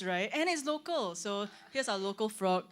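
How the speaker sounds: ordinary talk, nothing marked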